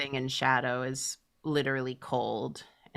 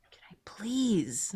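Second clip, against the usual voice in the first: whispered plea; 6 semitones higher than usual. Pretend. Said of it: "You're doing it".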